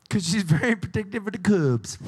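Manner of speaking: put-on terrible stab at a North Carolina accent. Host echoing the contestant's bear answer, hammed-up North Carolina accent.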